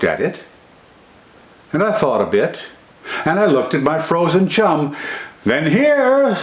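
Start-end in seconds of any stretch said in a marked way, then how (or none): 5.4-6.4 sudden cry. A narrator's reading. Narrator spots the derelict boat, crying out suddenly. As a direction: burst out with it, struck by the idea. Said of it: "says I with a sudden cry".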